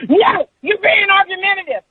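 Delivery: high-pitched screechy scolding; mock hollering, high and piercing; imitated yelling. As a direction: shriek it like an outraged coworker.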